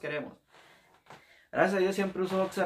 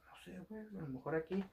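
hushed reply; 3 semitones higher, 11 dB quieter than usual. Retold or imitated. Recruit answering his buddy, hushed and unsure. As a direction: keep it hushed, not wanting to be heard. Recruits whispering, lost in barracks.

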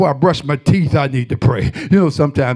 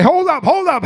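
mock protest; 12 semitones higher than usual. Preacher voices his own protest.

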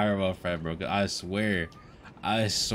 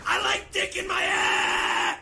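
mock high shout; 5 dB louder, slower than usual. Mock troll shout on stream.

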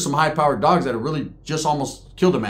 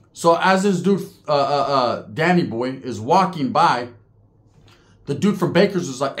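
ordinary talk, nothing marked

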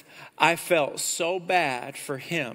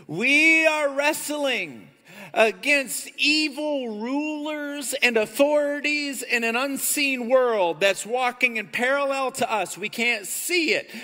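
preaching at full force; 6 semitones higher than usual. Acted. Pastor proclaiming Ephesians to his church, forceful.

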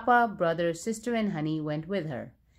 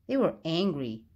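ordinary delivery, nothing marked

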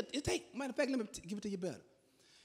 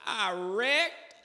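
drawn-out, high, loud wail from the wife; the wife shrieks the news slowly down the line; panicked wife on the phone; 8 semitones higher, 10 dB louder, much slower than usual. Retold or imitated.

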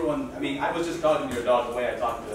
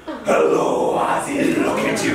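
put-on loud high baby talk to a dog; 6 semitones higher, 6 dB louder, slower than usual. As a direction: gush at the dog in a silly voice. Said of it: "talking to my dog that way".

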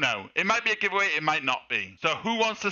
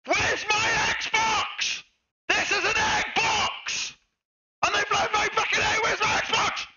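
outraged yelling; 13 semitones higher than usual. Real, not acted. Streamer shouting about his missing Xbox. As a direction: bellow the loss to the room. Prank victim ranting on stream.

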